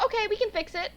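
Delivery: high, breezy mock podiatrist voice; imitating the podiatrist. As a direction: play the podiatrist as casually upbeat.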